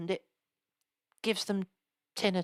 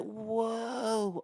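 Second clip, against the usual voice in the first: stoner surfer voice; 5 dB quieter than usual. Retold or imitated. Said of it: "does he be like".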